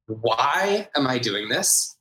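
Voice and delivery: "Why" lifted and leaned on, rest slow and even; slow question with weight on "Why"; stress on WHY. Shown as a demonstration. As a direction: hit the word "Why". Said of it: "emphasis on different words".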